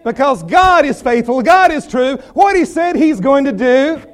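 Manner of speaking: building to a ringing proclamation; rousing preaching. Real, not acted. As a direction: preach it with mounting conviction.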